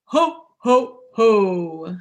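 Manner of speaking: belly-laugh like Santa. Acted. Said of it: "how do you think he laughed".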